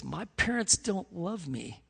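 soft, slow, subdued sorrow; retold hurt. Crying Johnny confiding in his youth pastor, quietly hurt. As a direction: quietly imitate an upset teenager confiding. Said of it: "He's crying".

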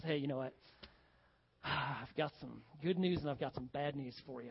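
a lightly voiced doctor, low and soft. Joke doctor telling his patient the news, lightly voiced. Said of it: "talk to his patients and goes".

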